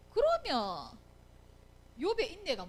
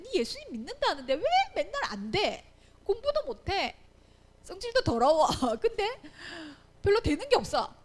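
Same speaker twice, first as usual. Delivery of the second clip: higher-pitched taunting, with a laugh; mocking unbeliever; 5 semitones higher than usual. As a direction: act out worldly people sneering at a believer. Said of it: "자, 세상 사람들이 볼 때". (비웃으며 빈정대는 세상 사람 말투)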